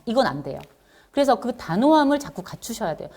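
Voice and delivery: normal talking voice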